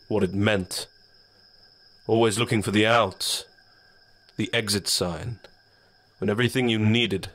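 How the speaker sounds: a rueful, reproaching dark spirit; shadow voice. Acted. Shadow's final rebuke.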